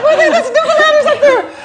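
laughing mock protest at Newfoundlanders; mock indignation. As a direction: squeal it like an offended local, laughing. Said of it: "It's like".

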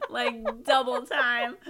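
ordinary delivery

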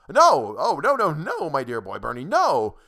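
loud, high, rushed protest; frantic denial. Acted. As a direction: hurry to undo a terrible mistake. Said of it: "Mister Cummings' frantic apologies".

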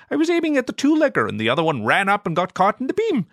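high, squeaky excuse-making alien; defensive alien Ziggy. Acted. Ziggy defends his old transmogrifier to Zorg, high defensive voice. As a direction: argue like a sulky kid caught out.

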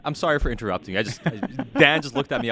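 laughing through the apology; words breaking into laughter; cracking up mid-line. Real, not acted. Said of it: "I was laughing 'cause".

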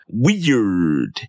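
put-on low, drawn-out "Weird" with a Spanish accent, V for W; imitated Spanish accent. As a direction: mimic Mondo saying "Weird" as "Veird". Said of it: "he was like".

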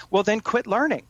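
high-pitched sarcastic quip. Real, not acted. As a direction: toss it out sarcastically, voice lifted. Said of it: "that was sarcasm".

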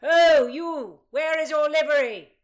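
high, loud accusing bark; a loud angry challenge; shout. Acted. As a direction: call them out across the hall. Blackjack challenging the pages.

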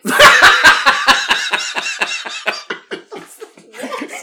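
loud shrieking burst of laughter; shrieking laugh at the story; high shrieking laugh. Real, not acted. Big laugh.

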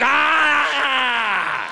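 long, high, loud mock war scream; berserk battle yell. Comic as Canadian handed a hockey stick, berserk yell.

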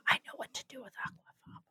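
breathy whispered boast. Acted. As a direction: whisper it conspiratorially.

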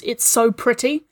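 usual speaking voice

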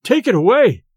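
frantic terror, first cry; terrified cry. A narrator's reading. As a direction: cry out as if something's choking you. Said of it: "as if a prey to the most awful terror".

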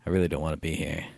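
soft, low resigned mutter; low, soft weary mutter; quiet mumble. Retold or imitated. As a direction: sigh it out under your breath. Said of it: "and I go".

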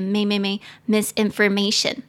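may repeated, then misinformation with the may syllable stressed. Shown as a demonstration. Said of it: "重音节在第四个音节".

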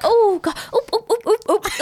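high squeaky oop oop oop; every tiny sound voiced aloud; mock fussy oops. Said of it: "it's like".

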